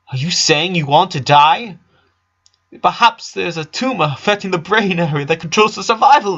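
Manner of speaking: put-on a small girl insisting on her guilt; guilty little genius. A narrator's reading. Lisa voice, clinical.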